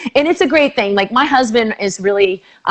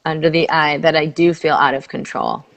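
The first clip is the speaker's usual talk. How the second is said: soothing tapping script; guided tapping; 5 semitones lower, 3 dB quieter than usual. Acted. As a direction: lead the phrase gently for her to repeat.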